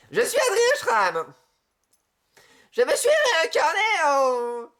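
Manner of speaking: high, slow put-on voice; high, slow character voice; playful character voice. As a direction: play a theatrical cat persona. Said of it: "Là, je suis en mode Félicia".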